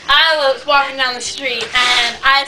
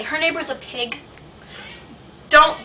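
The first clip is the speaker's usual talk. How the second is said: ordinary talk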